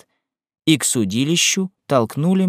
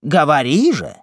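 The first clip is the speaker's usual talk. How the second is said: impatient raised-voice demand; shouting; 3 semitones higher, 4 dB louder than usual. A narrator's reading. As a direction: cry it out with a rising, insistent push. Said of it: "кричал Симон". (крик, требовательно)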